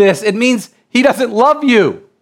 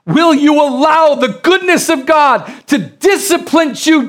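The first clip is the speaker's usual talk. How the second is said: fired-up preaching; 4 semitones higher than usual. Acted. Preacher urging the church through tough times, rising to a shout. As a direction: drive it home to the congregation.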